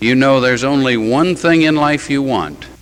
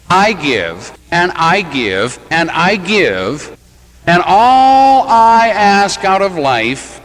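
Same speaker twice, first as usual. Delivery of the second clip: slow, building put-upon complaint, rising to a louder, higher peak; aggrieved martyr; 6 semitones higher, 3 dB louder, slower than usual. Acted. Hurt spouse listing sacrifices over the toothpaste cap, slow building grievance. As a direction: drag out each I give like a long-suffering spouse.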